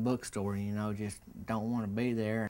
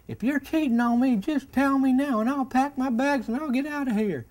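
put-on much higher and louder, an upset old man accusing; jealous old man; 8 dB louder than usual. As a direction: accuse him high and loud like a jealous old husband. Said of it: "And he said".

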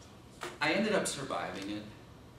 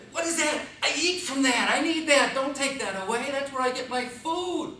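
high, loud desperate pleading; panicked pleading; 11 semitones higher, 8 dB louder than usual. Pretend. Newborn pleads with doctors not to take mother away.

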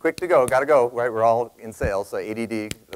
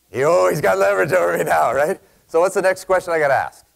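excited realization; 6 semitones higher, 5 dB louder than usual. Retold or imitated. Sudden realization told excitedly.

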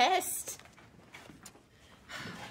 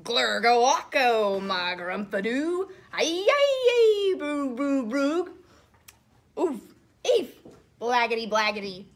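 hammed-up nonsense noises; silly sounds; 6 dB louder than usual. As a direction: go all out on the gibberish. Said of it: "make silly sounds".

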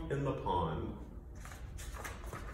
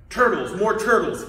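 loud, raised braying call; bellowing; 4 semitones higher, 10 dB louder than usual. Acted. Turtle king summons more turtles, bellowing. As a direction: bellow it across the pond. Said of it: "he bellowed and brayed".

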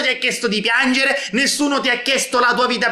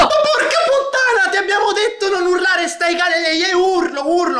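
exasperated yell; 7 semitones higher than usual. Acted. Yelling back at the shouting girl.

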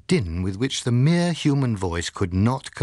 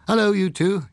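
bright, high-pitched cheerful greeting; 9 semitones higher, 4 dB louder than usual. A narrator's reading. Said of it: "he said cheerfully".

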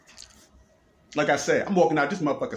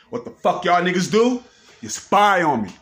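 loud, higher, furious accusations; angry yelling; 4 semitones higher, 4 dB louder than usual. Real, not acted. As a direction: bellow the accusations into the camera. Accuses police of spying, yelling.

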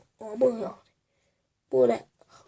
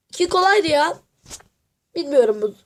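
ordinary talk, nothing marked

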